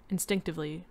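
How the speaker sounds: plain reading voice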